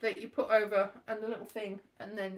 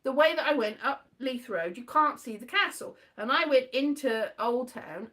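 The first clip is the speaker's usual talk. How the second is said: ordinary talk, nothing marked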